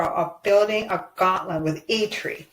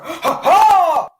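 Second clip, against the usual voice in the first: gleeful laugh; 6 semitones higher, 7 dB louder than usual. Real, not acted. Gleeful at Batroc's return.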